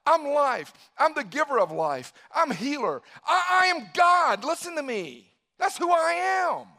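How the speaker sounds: loud, high, forceful proclamation; acted emphatic self-declaration, building to a shout; Jesus declaring himself. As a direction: announce it boldly in short bursts. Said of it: "Jesus goes".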